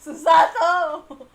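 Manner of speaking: slow, loud cry of her name through laughter; laughing scold. Real, not acted. Streamer laughing at how rude Susato is, loud and slow.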